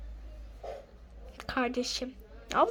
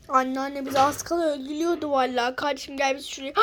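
ordinary talk

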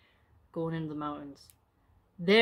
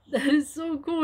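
excited; 8 semitones higher, 6 dB louder than usual. Real, not acted. Reader reacts delighted.

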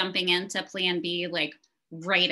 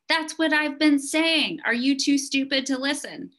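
all-caps yell; 9 semitones higher, 4 dB louder than usual. Retold or imitated. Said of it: "this is in all caps".

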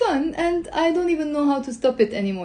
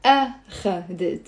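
أخذت broken into A, kha, det; lower, separated A-kha-det for أخذت; syllables split, first stressed; 4 semitones lower than usual. Shown as a demonstration. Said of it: "So the stress is on the أ".

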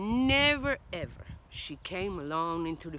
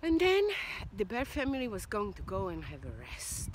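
usual speaking voice

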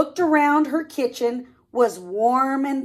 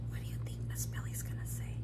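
fast breathy whisper, no voice; 16 dB quieter, much faster than usual. Acted. Reader whispering a question to the listening kids.

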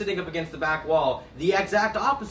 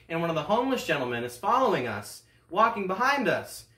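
ordinary delivery

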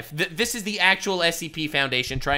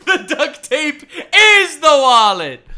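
slow, high words through laughter; laughing; 9 semitones higher, 9 dB louder than usual. Real, not acted. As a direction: crack up while saying it. Streamer cracks up rereading line.

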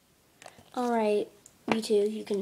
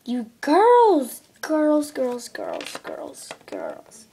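calling, fading away; 5 dB louder, much slower than usual. Acted. Mom calls, fading.

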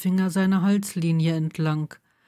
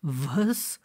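confused stammer; 4 semitones lower, 5 dB quieter than usual. A narrator's reading. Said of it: "stammelte Blaze verwirrt".

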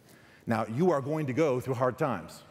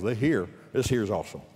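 folksy Texas accent on 'This here'; 4 semitones lower than usual. Shown as a demonstration. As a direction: say it like a Texan from Texas. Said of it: "That's how we say it in Texas".

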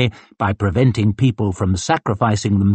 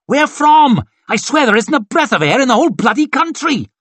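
high, loud, quick retort; exasperated shout; 14 semitones higher, 4 dB louder than usual. A narrator's reading. Onlooker complains there is no air, exasperated shout. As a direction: shout back high and fast.

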